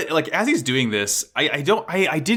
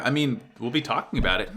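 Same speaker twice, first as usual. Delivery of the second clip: ordinary talk, nothing marked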